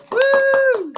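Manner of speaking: very high, loud whoop; excited cheer. Real, not acted. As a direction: hype up the crowd. Host hypes comic's entrance.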